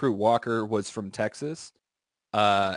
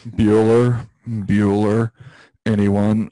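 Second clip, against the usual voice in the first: louder flat deadpan roll call; monotone roll call; 3 semitones lower, 8 dB louder, much slower than usual. Retold or imitated. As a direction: read it bored and droning. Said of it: "speaks in the monotone".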